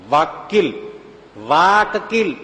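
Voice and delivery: punning on the word વકીલ; wordplay spelling-out. Shown as a demonstration. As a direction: stretch વાકીલ into વાક-કીલ, leaning on કીલ. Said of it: "કીલ એટલે ખીલો".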